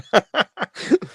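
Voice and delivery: high burst of real laughter; amused laugh, high and loud. Real, not acted. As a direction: crack up at a comment. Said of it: "I'm just laughing".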